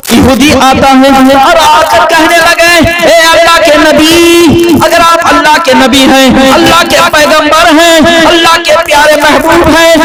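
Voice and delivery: preaching. Acted. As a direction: preach the tale to the gathering.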